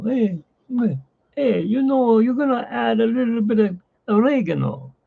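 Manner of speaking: high, hammed-up Italian accent; Italian chef accent. Showing his cooking stream, put-on Italian accent. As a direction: ham up an Italian cook. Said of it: "talking like Italiano".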